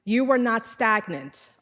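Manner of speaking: normal talking voice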